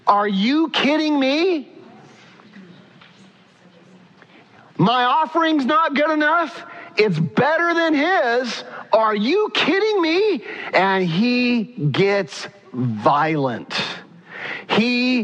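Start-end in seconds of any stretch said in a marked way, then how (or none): 0.0-10.4 much higher, hammed-up indignant outburst; mock outrage at the rejected offering; irate Cain. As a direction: Cain protesting to God, hammed-up angry. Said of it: "He was irate".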